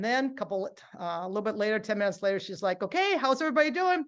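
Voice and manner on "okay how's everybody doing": high, quick call to the class; upbeat instructor. Retold or imitated. Aerobics instructor checking on her class, upbeat. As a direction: chirp it out fast to a group.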